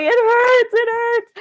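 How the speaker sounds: put-on very high, loud childlike wail; crying child. Small child to grandma after kicking her knee, high loud wail. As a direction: wail it like a small kid in tears. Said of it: "And I'm like".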